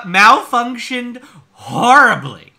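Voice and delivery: slow, loud, high, scornful repeat; hammed-up disbelief at the wording; mocking quote. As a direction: stretch each word in exaggerated horror. Mockingly quoting the reviewer's wording.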